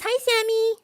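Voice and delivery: high squeaky voice for the cat. Pretend. Speaking for her cat to a viewer, high cat voice. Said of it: "Cat says".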